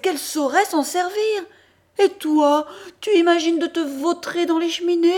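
loud, high-pitched tirade; furious scolding. Acted. Mother scolding the boy: furious, loud, high tirade. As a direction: berate him, rising to a frenzy.

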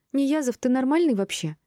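high-pitched indignant challenge. A narrator's reading. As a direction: demand it in high, affronted disbelief. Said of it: "возмутилась Мира".

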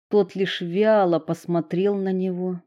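plain reading voice

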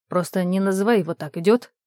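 ordinary delivery

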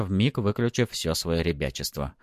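ordinary delivery, nothing marked

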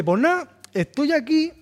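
goofy game character. Retold or imitated. Describing a game character to friends, high cartoon voice. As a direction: chirp it like a cheerful cartoon NPC. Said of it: "y dice".

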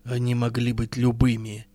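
low, grim dismissal. Acted. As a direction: say it darkly and heavily. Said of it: "мрачно сказала Махаст".

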